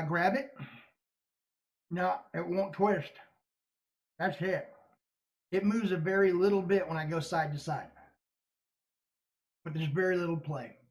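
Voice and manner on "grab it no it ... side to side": speaking around own hand; talking with fingers in mouth. Real, not acted. Seen 1: Fingers inside mouth gripping lower denture from 0.8s onward.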